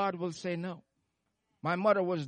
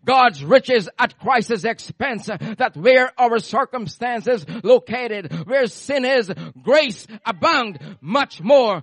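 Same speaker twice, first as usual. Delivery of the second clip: slow, loud letter-by-letter spelling; spelled out slowly; 7 dB louder, slower than usual. Shown as a demonstration. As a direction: spell out grace, each letter landing. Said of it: "I can spell it out".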